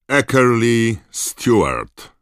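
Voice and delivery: a teacher calling names to the hall, slow and loud; stern roll call. A narrator's reading. McGonagall calling a first-year forward, slowly and loudly.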